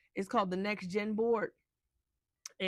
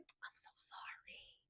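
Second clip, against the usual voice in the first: breathless whispered apology, slow; a slow hushed whispered sorry; 22 dB quieter, much slower than usual. Retold or imitated. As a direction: whisper it, barely there, drawn out. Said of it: "And she was like".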